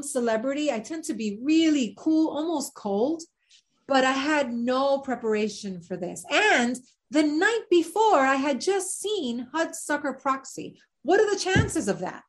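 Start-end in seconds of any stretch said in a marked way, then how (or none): none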